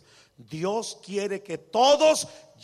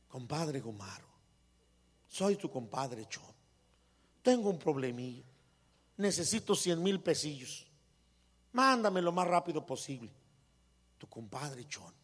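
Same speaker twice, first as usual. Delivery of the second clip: much softer and lower, unhurried; gentle polite letter; 4 semitones lower, 5 dB quieter than usual. Retold or imitated. Wife reading compadre Chon's letter to calm her husband, soft and gentle. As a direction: read the same letter kindly and humbly. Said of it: "era la misma carta".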